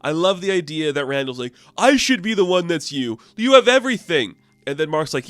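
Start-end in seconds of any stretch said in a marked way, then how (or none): 1.8-4.4 rising, higher and louder resentment; jealous outburst. Retold or imitated. Randall accusing his brother Mark, rising to a shout. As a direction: build to an envious yell.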